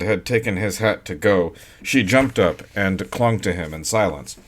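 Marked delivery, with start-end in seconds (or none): none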